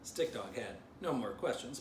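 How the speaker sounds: usual speaking voice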